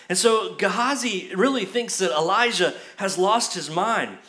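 ordinary talk, nothing marked

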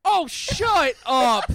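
loud, drawn-out slow groan of annoyance; exasperated shout. Real, not acted. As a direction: yell it, fed up but laughing. Reacting to teasing card.

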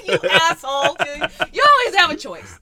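loud, high laughing protest; laugh-shout at him in mock outrage. Real, not acted.

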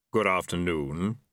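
slow, low reaper voice; grim reaper voice. A narrator's reading. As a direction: speak as Death, measured and slow. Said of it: "said Death".

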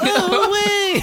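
very high, laughing "Oh, wee"; high laughing squeal. Retold or imitated. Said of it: "and you're like".

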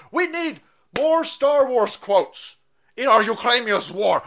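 usual speaking voice

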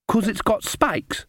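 put-on high, slow child voice; matter-of-fact little girl.

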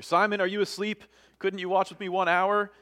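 reproachful question. A narrator's reading. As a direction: rouse a sleeper with dismayed questions. Reads Jesus reproaching sleeping Peter.

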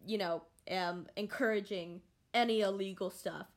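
usual speaking voice

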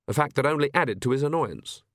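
plain reading voice

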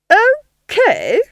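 shrieked, high incredulous echo; scandalised villainess squawk; outraged disbelief. A narrator's reading. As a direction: throw it back, loud and high. Duchess echoes Nanny in disbelief.

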